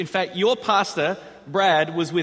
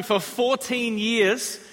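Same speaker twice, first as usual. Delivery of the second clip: ordinary talk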